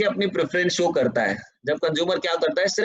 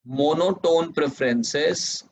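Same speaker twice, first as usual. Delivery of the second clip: very slow, each phrase repeated; teacher dictating notes word by word; slow dictation; much slower than usual. Shown as a demonstration. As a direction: dictate the definition for students to copy. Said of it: "dictating second definition".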